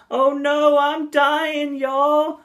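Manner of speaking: very high-pitched southern puppet voice; mock dying wail with a southern twang; squeaky southern dragon, hamming up his death; exaggerated southern accent. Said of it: "uh, southern accent".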